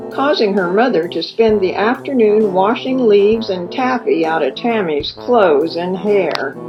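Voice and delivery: plain reading voice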